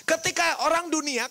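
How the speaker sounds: ordinary delivery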